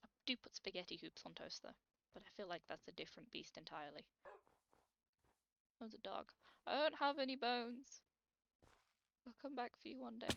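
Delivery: ordinary talk, nothing marked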